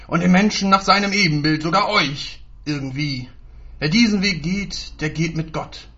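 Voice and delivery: loud, threatening promise; drohendes Versprechen. Acted. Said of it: "Tonfall klang weniger verheißungsvoll als drohend". (laut und drohend verheißen)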